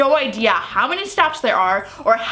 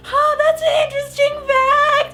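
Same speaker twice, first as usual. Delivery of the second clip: strained high-pitched fake politeness; scared laugh-cry fake polite reply; terrified squeak; 12 semitones higher than usual.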